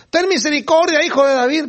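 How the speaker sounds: high-pitched imitation of blind men calling; crying out. Blind men following the Lord through the crowds, crying out. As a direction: call out pleadingly, pitched well up.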